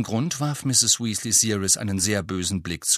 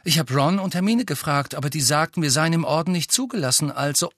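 higher, indignant protest; 6 semitones higher, faster than usual. A narrator's reading. Boy explaining that he did ask his friends, indignant. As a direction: pour out an indignant defence. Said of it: "sagte Harry entrüstet".

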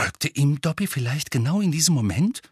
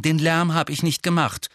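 firm denial, pitched up; 6 semitones higher than usual. A narrator's reading. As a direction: deny it decidedly. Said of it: "sagte Harry entschieden".

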